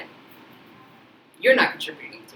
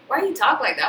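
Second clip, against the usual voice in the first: higher, lightly voiced friend; imitating friend; 8 semitones higher, 6 dB louder than usual. Friend asking why she talks that way, lightly mimicked. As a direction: toss it off like a teasing friend. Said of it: "my friend was like".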